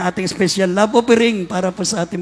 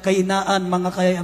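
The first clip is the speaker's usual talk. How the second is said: normal talking voice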